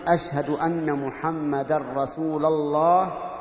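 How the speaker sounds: "رسول" as rasūla, slow and drawn out; muezzin's grammatical slip. Shown as a demonstration. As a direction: stretch "الله" slowly after rasūla. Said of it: "يكون قول المؤذن". (نطق "رسول" بالفتح مع مد "الله")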